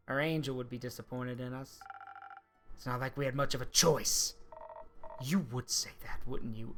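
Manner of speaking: ordinary talk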